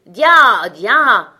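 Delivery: loud, long carter's cry; shouted driving call. A narrator's reading. Little driver turning the cart at a hedge, shouting. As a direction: holler it out at the horse. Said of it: "tandis que le petit criait".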